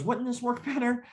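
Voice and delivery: "Wouldn't this work better?" lifted high and innocent; high mock-innocent question. Matt innocently challenging the instructor, high mock-sweet. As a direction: ask it sweetly, eyebrows up. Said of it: "he goes".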